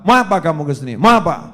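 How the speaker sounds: very loud, forceful challenge; gallant, authoritative. Shown as a demonstration. Preacher shows how to face an enemy, loud commanding challenge. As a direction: confront the foe with full authority. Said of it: "Nunjukkan wibawa".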